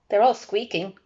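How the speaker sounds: plain reading voice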